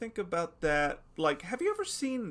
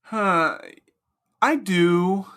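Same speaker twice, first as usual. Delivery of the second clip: loud, leering philosopher; eager Socrates; 3 semitones higher, 9 dB louder than usual. Acted. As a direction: sell it with hungry enthusiasm. Socrates pushing male-only games.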